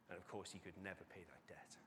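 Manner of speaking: whispered solemn aside; hushed whisper. Real, not acted. Preacher whispers to the congregation that the debt is unpayable. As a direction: drop to a whisper for the point.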